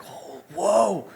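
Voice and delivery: high, drawn-out mock astonishment; amazed fans, imitated. Online followers seeing rare pairs, mock amazed. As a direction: gush in a high voice, trailing into blahs.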